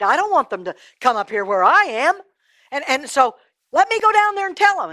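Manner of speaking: high, insistent appeal; urgent pleading. Acted. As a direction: argue desperately to be sent as a messenger. Rich man begs urgently for brothers.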